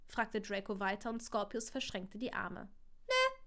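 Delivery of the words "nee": put-on high, defiant little-boy "Nee"; sulky child. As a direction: snap it like a stubborn small child. Sulky child refuses father.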